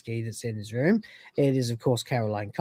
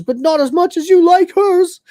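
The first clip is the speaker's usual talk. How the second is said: high, loud jealous wail; hammed-up sulky accusation; jealous whining; 13 semitones higher, 8 dB louder than usual. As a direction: whine back in mock hurt. Crichton's jealous whine, acted out.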